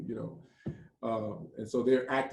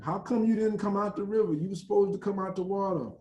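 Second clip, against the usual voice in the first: retold anguished plea; 8 semitones higher than usual. Citizen confronting the drowned man.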